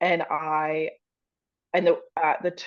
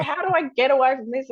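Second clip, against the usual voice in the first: high, loud, hurried plea; mock panic; 8 semitones higher, 6 dB louder, faster than usual. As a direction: blurt it out as if desperate to escape. Retells own panic on stage.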